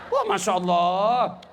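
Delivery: slow, stretched exclamation of wonder; drawn-out marvelling. Acted. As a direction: draw it out in exaggerated amazement. Marvels at being kissed. (takjub, diucapkan panjang)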